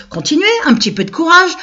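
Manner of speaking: high, loud mock cheering-on; encouragement moqueur. Taunts the fakers to keep going. (encouragement ironique, aigu et fort)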